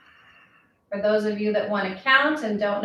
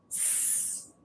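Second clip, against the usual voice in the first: very soft unvoiced hiss; a thin sss from the belly; snake hiss; 20 dB quieter than usual. Shown as a demonstration. Teacher contrasts the hiss with the shush, very soft. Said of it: "but instead of sh, it's".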